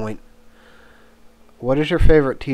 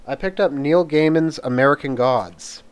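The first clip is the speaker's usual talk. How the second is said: normal talking voice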